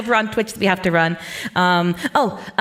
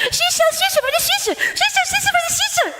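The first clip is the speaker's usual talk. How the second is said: She sells seashells by the seashore pitched much higher, slow and tongue-tied with sh for every s; high-pitched tangled attempt; slower than usual. Shown as a demonstration. Streamer failing the tongue twister for chat, high and garbled. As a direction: squeak it out high and let the sounds slur into sh. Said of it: "you want me to do a tongue twister".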